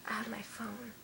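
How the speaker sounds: quick hushed whisper. Real, not acted. Whispered fast aside.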